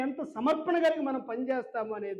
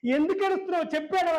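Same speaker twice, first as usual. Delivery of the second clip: quick, higher-pitched exasperated demand; retold pestering grandson, quick and pitched up; insistent questioning; 7 semitones higher, faster than usual. Grandson demanding to know why grandmother cries, insistent.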